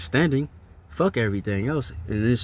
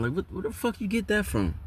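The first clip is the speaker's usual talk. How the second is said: much higher, disbelieving exclamation; incredulous outburst; 9 semitones higher, 4 dB quieter than usual. Real, not acted. Mocking the tribe of Dan claim, incredulous higher outburst. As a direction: demand to know where he got that. Ranting at bishop's changing doctrine.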